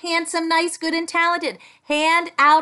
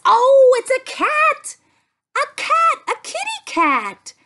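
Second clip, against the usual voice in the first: high, loud delighted cry; thrilled to find a cat; excited discovery; 8 semitones higher, 5 dB louder than usual. Real, not acted. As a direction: gasp it out with joy.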